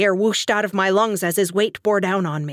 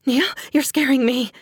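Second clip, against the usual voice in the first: higher, short-breathed fearful plea; scared wife, pitch raised; forced out, frightened; 6 semitones higher, 3 dB louder than usual. A narrator's reading. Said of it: "I forced out on a shallow breath".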